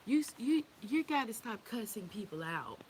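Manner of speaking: imitated children. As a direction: mimic your children scolding their mother. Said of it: "When my children say".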